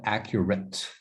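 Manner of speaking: "accurate" with a soft open A at the start. Shown as a demonstration. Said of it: "the A is like a soft A".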